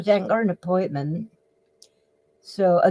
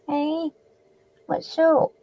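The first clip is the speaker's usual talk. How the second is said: high, gentle cooing; 8 semitones higher, slower than usual. Real, not acted. Talking to a small child.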